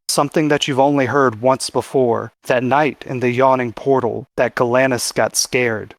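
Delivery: ordinary talk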